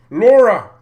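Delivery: loud, high one-word shout; calling out. Real, not acted. Streamer suddenly exclaiming a name mid-question, calling out.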